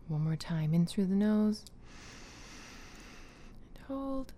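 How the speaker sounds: soft whispered breathing cues; guided breathing. Acted. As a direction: lead the breaths gently and quietly. Leads a breathing exercise.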